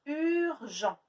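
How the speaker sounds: urgent, leaning hard on the opening u; stressing the U. Shown as a demonstration. Teacher to pupils, leaning on the u sound. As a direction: bring out the u sound. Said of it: "Est-ce qu'on entend U".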